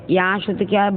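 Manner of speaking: usual speaking voice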